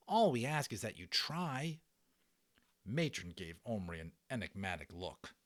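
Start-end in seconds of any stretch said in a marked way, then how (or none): 0.1-1.8 lightly raised, earnest plea; earnest pleading boy. Acted. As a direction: beg her sincerely, a touch higher. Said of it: "Omri said earnestly".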